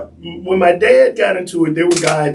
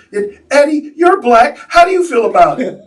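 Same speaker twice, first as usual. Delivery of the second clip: higher, loud mock of a nosy tourist; mocking the lady; 9 semitones higher, 4 dB louder than usual. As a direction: jab the question like a tactless tourist. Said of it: "one lady says".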